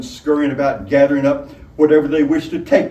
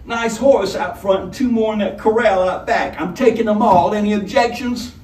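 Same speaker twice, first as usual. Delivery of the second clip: higher, louder gloating robber voice; smug robber; 9 semitones higher, 4 dB louder than usual. A narrator's reading. Robber gloating over the tavern folk, smug and satisfied. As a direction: savor every word like loot. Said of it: "with obvious lip-smacking satisfaction".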